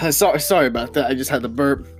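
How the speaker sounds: ordinary delivery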